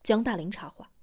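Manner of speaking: usual speaking voice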